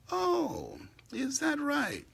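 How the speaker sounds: high mock surprise; sarcastic disbelief. Real, not acted. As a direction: throw back a high sarcastic question. Sarcastic retort.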